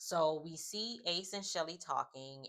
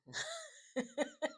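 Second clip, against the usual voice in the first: sudden high burst of laughter. Real, not acted. Amused by the reality show drama, bursts into laughter. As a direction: break into a high squealing laugh.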